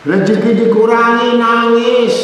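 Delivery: high, impassioned sermon cry; a preacher thundering against hypocrites, in a high, fervent voice; fiery preaching. Acted. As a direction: rebuke the flock with rising fervor. Long impassioned sermon passage.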